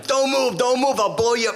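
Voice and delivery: high-pitched forceful police yell; retold shouted command. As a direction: bark it as an urgent threat. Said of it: "And I'm yelling at him".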